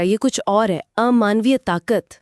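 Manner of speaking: ordinary talk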